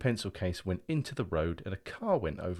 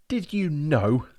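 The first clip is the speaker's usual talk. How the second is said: demanding; 8 semitones higher, 9 dB louder, much slower than usual. Acted. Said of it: "I demanded".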